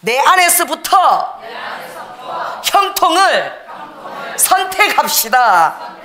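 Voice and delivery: high, loud, slow exhortation with a laugh; full-force preaching. Acted. Preacher rallying the church, loud and slow. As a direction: drive each word home to the church.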